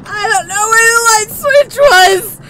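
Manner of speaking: high, loud frightened yell; panicked shout. Real, not acted. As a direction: shout it in fear. Panicked in dark.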